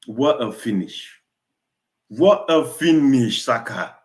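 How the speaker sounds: ordinary talk, nothing marked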